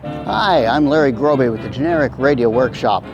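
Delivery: ordinary delivery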